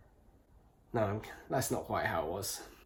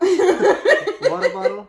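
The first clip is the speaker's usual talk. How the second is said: loud, high, drawn-out American accent; hammed-up American accent; 18 dB louder, much slower than usual. Shown as a demonstration. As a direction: blow up the American accent, laughing. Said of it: "your American accent".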